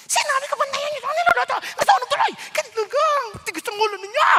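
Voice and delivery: high, loud scolding host; scolding the helpers over burnt food, loud and high. Retold or imitated. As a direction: yell at the cooks, shrill and loud. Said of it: "Ganyan, ang lakas ng boses".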